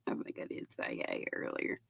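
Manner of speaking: breathy whisper to herself; quick hushed aside. Real, not acted. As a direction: drop to a whisper, as if thinking aloud.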